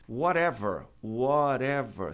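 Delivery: "whatever" with the T as a tapped R; flapped T. Shown as a demonstration. Teacher giving another example word, flapped T. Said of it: "T ladeado por vogal".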